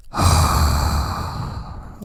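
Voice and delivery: long, deep, breathy sigh of relief; huge sigh. Retold or imitated. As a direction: let out the biggest sigh, like after a long run. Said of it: "a biggest sigh ever".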